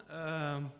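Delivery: ordinary talk, nothing marked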